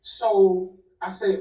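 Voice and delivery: ordinary talk, nothing marked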